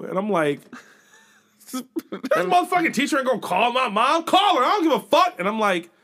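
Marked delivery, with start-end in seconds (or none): 1.7-5.4 loud high defiant rant; high loud mock bravado; defiant yell. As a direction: yell it like a cocky kid. Classmate defiant ranting.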